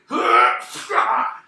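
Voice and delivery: loud, breath-heavy heave noise; explosive mock exertion grunts; imitated lifting grunt.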